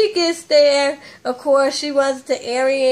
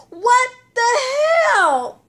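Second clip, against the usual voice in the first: sudden higher yelled disbelief; shocked outburst; 11 semitones higher, slower than usual. Retold or imitated. Vlogger reacting to Mama D's street clothes, shouted shock. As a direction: blurt it out, stunned.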